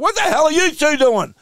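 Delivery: angry footy coach blasting his players; coach telling off. Retold or imitated. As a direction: bark at lazy players like a coach. Coach berating players quote.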